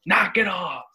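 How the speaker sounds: high-pitched mock dad yell; Diesel. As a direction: imitate a father's sharp holler. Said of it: "Do you remember Diesel saying".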